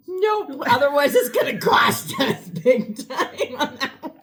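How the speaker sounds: high, loud, laughing retort; laughing refusal. Retold or imitated. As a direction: refuse loudly, breaking up with laughter. Acting out own laughing refusal.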